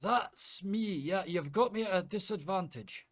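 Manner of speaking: high, loud apologetic Scotsman; flustered Scottish innkeeper apologising; Scottish brogue, sorry and shaken; Scottish accent. Acted. GM plays Callum.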